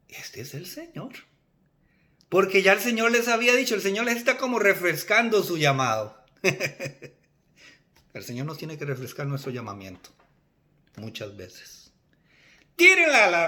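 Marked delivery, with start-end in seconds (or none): 0.1-1.1 hushed realization. Acted. Disciples recognizing the Lord, in a hushed voice. 12.8-13.5 loud, high-pitched shouted order; calling out. Acted. Jesus telling the fishermen where to cast, shouted.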